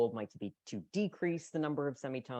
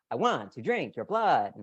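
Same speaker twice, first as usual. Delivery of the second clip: loud swooping vampire voice, big pitch leaps; Dracula intonation; 2 semitones higher, 7 dB louder than usual. Shown as a demonstration. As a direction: ham up a Dracula line to break out of monotone. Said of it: "this is the Dracula intonation".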